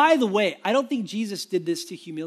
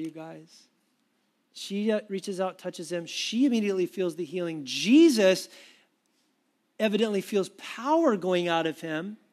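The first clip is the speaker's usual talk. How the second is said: usual speaking voice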